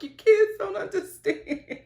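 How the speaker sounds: high, drawn-out imitated wail; mock crying. Imitating mom crying over a show, mock tearful. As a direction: imitate a weepy mom, half laughing. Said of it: "who started crying, and she was just like".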